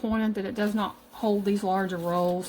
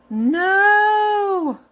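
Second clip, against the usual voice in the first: high, loud shocked no; shocked shout; 13 semitones higher, 11 dB louder than usual. Real, not acted. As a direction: cry out in dismay. Sees bread crushed.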